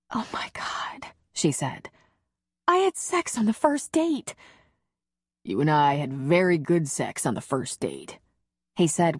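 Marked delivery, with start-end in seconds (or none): 0.1-1.1 unvoiced, hushed whisper; breathless whisper. Heroine overwhelmed after lovemaking, whispering. 2.7-4.3 much higher, lightly voiced woman; heroine's voice. Heroine marveling to her lover, higher woman's voice. 5.4-8.2 slightly lower, lightly voiced man; hero's voice. Hero reassuring the heroine, lightly lower man's voice.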